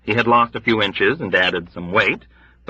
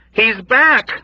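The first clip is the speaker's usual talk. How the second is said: high, loud delirious echo; loud terrified repeat; 13 semitones higher, 5 dB louder than usual. Acted. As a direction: cry it out in fevered terror. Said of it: "echoed in automatic terror".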